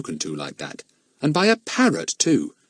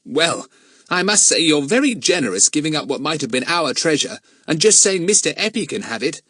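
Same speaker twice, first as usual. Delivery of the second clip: exasperated; 7 semitones higher than usual. A narrator's reading. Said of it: "said Jack, exasperated".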